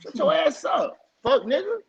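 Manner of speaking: high-pitched mock telling-off; indignant retort. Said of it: "I'm like".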